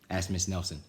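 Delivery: plain reading voice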